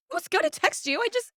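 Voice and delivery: high-pitched, affronted imitation; mock flustered protest. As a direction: sputter it high, as if wounded. Mimics man flustered at rejection.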